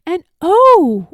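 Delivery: high, loud burst of wonder; delighted oh. A narrator's reading. Narrator marvels at the bird to the child, loud and high. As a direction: gasp it out in delight.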